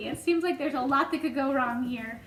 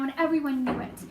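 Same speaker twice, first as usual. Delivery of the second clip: ordinary delivery, nothing marked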